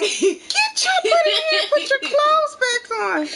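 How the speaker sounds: high, loud panicked shout; frantic order. Retold or imitated. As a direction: yell at the kid to get inside now. Mom orders daughter inside.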